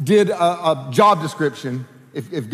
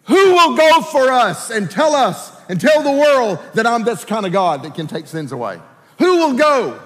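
raised, ringing appeal to a crowd; voice of the Lord calling out; 4 semitones higher than usual. Retold or imitated. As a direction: call it out like a summons. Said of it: "then the Lord said".

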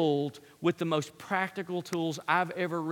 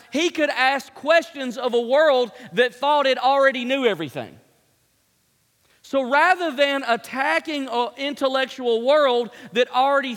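louder, higher, driving the point home; preaching at full force; 5 semitones higher, 5 dB louder than usual. Acted. Pastor warning his congregation, in full-force preaching. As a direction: preach it hard to the hall.

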